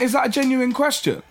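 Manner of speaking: high, pointed, mock-serious question; incredulous challenge. Quizzing the Millets guy, high sarcastic disbelief. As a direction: needle him with disbelief.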